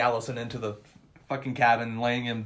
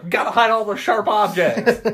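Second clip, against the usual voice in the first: high, louder, laughing through it; laughing mock voice; 9 semitones higher, 7 dB louder than usual. Friend acting out Tucker, high and laughing. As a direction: play Tucker panicking, comic. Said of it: "Tucker's like".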